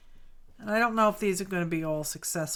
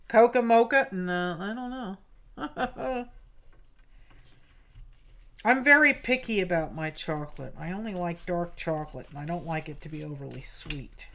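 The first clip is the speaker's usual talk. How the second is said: usual speaking voice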